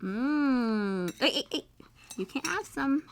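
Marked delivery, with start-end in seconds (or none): none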